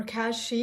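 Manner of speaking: cachet as "cashee"; mispronunciation. Retold or imitated. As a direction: say cachet ending in ee. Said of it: "someone pronouncing this word as".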